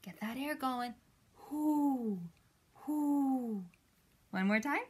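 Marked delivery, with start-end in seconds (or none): 1.3-3.6 long, breathy owl hoots; drawn-out owl call; owl hooting. Shown as a demonstration. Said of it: "An owl says".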